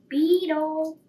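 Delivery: high loud call of the name. Acted. Sparrow calls out to the sleeping beetle, loud. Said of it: "he called".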